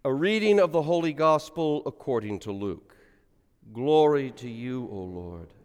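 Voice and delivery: solemn liturgical reading aloud; gospel reading. Acted. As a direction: intone the gospel for the congregation.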